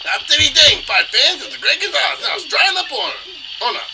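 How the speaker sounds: high, loud hype voice; excited ring announcer. Acted. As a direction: shout it out to the fight fans.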